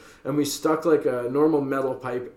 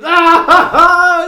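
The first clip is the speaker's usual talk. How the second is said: high, loud taunting cackle; mocking laugh; 12 dB louder, much slower than usual. Friends taunting roommate, a high loud mocking laugh. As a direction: laugh at him to rub it in. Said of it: "start laughing to each other".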